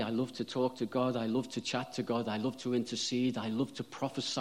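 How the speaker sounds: usual speaking voice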